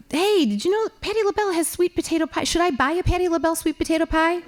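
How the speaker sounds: high, fast, chirpy shopping chatter; ditzy chatter. Retold or imitated. Wife chattering to husband in Walmart, high and quick. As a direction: rattle it off brightly and fast.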